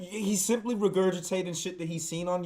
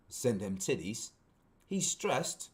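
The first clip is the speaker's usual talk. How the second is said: softer, lower British read; British accent; 4 semitones lower, 4 dB quieter, slower than usual. Shown as a demonstration. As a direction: put on a quiet British accent. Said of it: "read this in a British accent".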